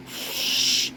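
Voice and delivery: soft voiceless "shh"; hissing sound effect. A narrator's reading. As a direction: let out a quiet rush of air. Imitated hiss in story.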